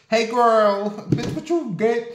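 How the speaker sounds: imitating crazy lady. Imitating the neighbor again.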